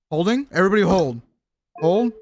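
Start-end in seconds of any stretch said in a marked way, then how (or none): none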